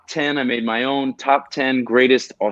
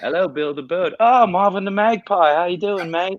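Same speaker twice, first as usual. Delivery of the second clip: high, far-off Aussie voice greeting the birds; cheery Australian accent, pitched well up; 7 semitones higher than usual. Shown as a demonstration. Greeting Bill the Bird and Marvin the Magpie, high Australian accent. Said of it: "you could pull off the Aussie accent so well".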